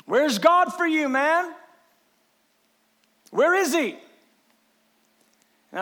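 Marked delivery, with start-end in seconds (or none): 0.0-4.0 raised, challenging questions; taunting critic. Acted. Critics challenging a man whose life falls apart, openly taunting. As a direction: throw it out as an open taunt.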